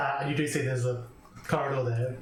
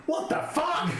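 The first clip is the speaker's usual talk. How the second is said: high-pitched incredulous exclamation; startled "what the hell" yelp; startled outcry; 13 semitones higher, slower than usual. Acted. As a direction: cry out like someone startled next door. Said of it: "you hear from the next room".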